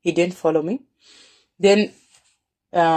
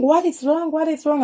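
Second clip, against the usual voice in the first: much higher, anxious repeated question; alarmed sisters; 10 semitones higher than usual. Retold or imitated. Sisters alarmed at their sister's strange request, high and anxious. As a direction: ask it twice in a high, worried pitch.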